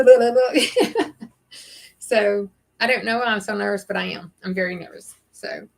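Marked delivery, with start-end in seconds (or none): 0.0-1.3 slow blah blah blah with laughter in it; laughing it off. Real, not acted. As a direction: laugh through the words at your nerves. Laughs at her own nerves.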